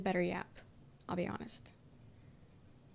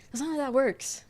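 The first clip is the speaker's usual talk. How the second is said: louder, exasperated correction; 4 semitones higher, 4 dB louder than usual. Real, not acted. Streamer fed up with people asking her to psychoanalyze them, louder. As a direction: set them straight, fed up.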